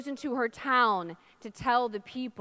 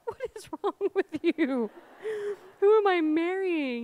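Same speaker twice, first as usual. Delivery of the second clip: higher, louder toward the end, hammed-up dismay; mock bewildered; 8 semitones higher, 4 dB louder than usual. Groom to his squealing bride, mock bewildered. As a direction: imitate an aghast groom. Voicing her baffled groom at altar.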